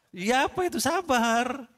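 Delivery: high-pitched, stretched-out repeat question; insistent questioning. Real, not acted. Preacher asking the congregation yet another time, insistent.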